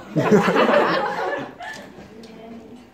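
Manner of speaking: loud laughing burst. Real, not acted. Laughing at his own jail line.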